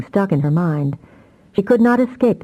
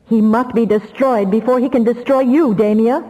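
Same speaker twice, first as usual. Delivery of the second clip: inexorable; 6 semitones higher than usual. Acted. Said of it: "inexorable decision with the regret".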